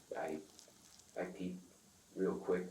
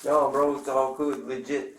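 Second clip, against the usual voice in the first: imitated pleading dealer; 8 semitones higher, 11 dB louder, slower than usual.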